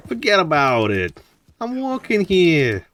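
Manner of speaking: hammed-up New York accent, high and slow. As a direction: drag it out like a cartoon New Yorker. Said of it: "How does your New York accent".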